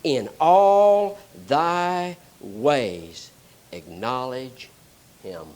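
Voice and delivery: very slow, weighty, each word drawn out; slow scripture reading. Shown as a demonstration. Preacher slowly reads the verse to the congregation, weighty and drawn out. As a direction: let every word of the verse sink in. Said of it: "Now notice what it says here".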